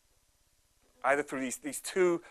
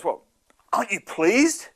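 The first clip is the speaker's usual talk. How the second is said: puzzled dad; 8 semitones higher, 7 dB louder than usual. Acted. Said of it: "And Dad goes".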